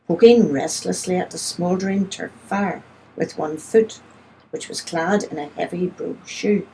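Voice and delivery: ordinary delivery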